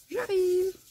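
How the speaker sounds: high, loud call from another room; husband calling out. Retold or imitated. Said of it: "monsieur m'appelle et me dise".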